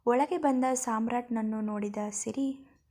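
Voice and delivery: usual speaking voice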